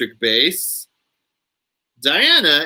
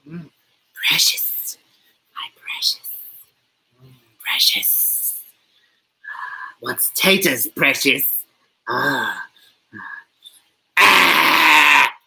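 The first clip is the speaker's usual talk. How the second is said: whispery creature voice breaking into voiced cries; Gollum. Streamer performing for viewers who want an impression, whispery Gollum voice. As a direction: whisper and croon greedily over your precious. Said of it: "I can do an okay Gollum impression".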